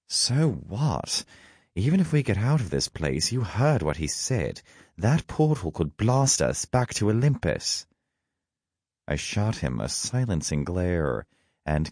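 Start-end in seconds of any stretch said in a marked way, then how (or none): 0.1-7.9 higher-pitched Loki, arguing; worked-up sidekick, pitched up; agitated companion. A narrator's reading. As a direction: argue back in a raised-pitch character voice. Narrator voices Loki, agitated companion.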